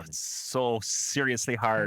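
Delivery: badly faked Scottish, sliding into Jamaican; muddled Scottish accent. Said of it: "that was Jamaican and Scottish".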